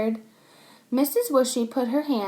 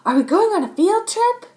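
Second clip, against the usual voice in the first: put-on high, loud excited child; eager kid question; 10 semitones higher, 8 dB louder than usual. Robbie asks the teacher eagerly, high and loud. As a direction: bounce with hope of a trip. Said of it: "asked Robbie".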